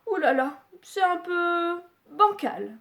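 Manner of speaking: put-on a little girl complaining; Goldilocks voice. Little girl finding Papa Bear's chair too big, mildly voiced.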